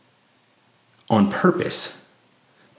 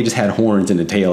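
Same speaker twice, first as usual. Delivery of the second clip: ordinary talk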